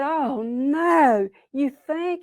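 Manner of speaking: high, worried reaction; dismayed Possum. Acted. Possum dismayed about dirty tail.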